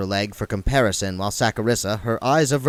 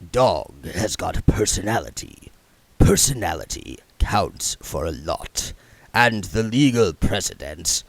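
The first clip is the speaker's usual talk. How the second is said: odd lawyerly voice; 2 semitones lower than usual. A narrator's reading. Another figure voiced.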